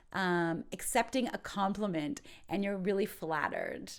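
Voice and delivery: ordinary delivery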